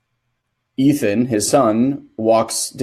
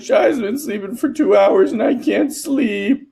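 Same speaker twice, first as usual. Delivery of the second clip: put-on little boy voice; 12 semitones higher than usual. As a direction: whimper it like a sleepless kid. Crying child quoted.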